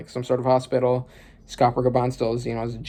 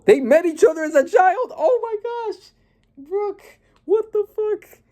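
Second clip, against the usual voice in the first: very high stunned disbelief; shocked excitement. Real, not acted. Realizing the two met as children, very high and stunned. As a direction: voice shoots up in shock.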